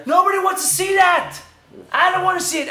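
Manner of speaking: loud, high outraged outburst; exasperated protest. Acted. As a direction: cry out in comic disgust. Ranting about what he saw.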